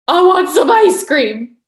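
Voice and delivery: loud, high, drawn-out craving; eager. Retold or imitated. Retelling her answer to what makes her happy, eager. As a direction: announce a treat with mock childlike glee.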